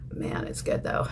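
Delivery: breathy whisper to herself; whispered aside. Real, not acted. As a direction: let it slip out under your breath. Aside about expensive lip product.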